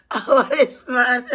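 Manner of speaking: loud, slow words through laughter; real laughing. Real, not acted. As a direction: burst out laughing as you speak. Cracks up after mock crying.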